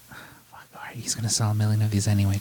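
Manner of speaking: whispered, fast and resigned. Retold or imitated. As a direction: mutter it under your breath, giving in. Label execs grumbling about [name].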